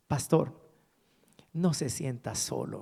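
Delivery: low, softened reassurance; retold gentle consolation. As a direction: console him quietly, pausing after the address. Retells pastor's consoling message. (consuelo suave, en voz más baja)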